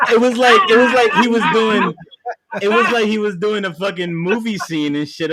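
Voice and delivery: high, loud and excited. Real, not acted. Friend hyped about Delroy Lindo on the news, excited. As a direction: tell it with rising excitement.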